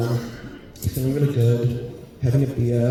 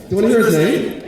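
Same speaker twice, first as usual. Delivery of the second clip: higher and louder, teasing; excited tease; 7 semitones higher, 5 dB louder, faster than usual. Shown as a demonstration. Comic teasing the audience with his husband's name, louder and higher. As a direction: lift it up to hook the crowd.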